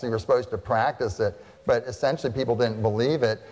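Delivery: usual speaking voice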